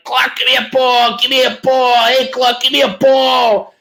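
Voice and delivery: put-on high kid voice calling for a ball; kid begging for a ball. Kid in the stands begging Clark for a ball, high. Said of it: "he's like".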